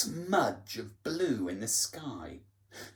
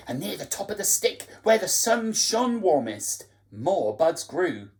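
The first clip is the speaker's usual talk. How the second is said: ordinary delivery, nothing marked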